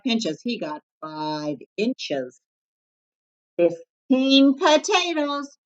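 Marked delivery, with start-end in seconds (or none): 3.6-5.6 high, slow barked command; bossy chef barking; barked order. A narrator's reading. Varmint ordering the bunny, high and slow. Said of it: "barking orders".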